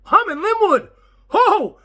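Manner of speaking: sudden high shout of realization; startled realization. Real, not acted. To himself, realizing he's in Linwood: startled high shout. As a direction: jolt awake and shout it out.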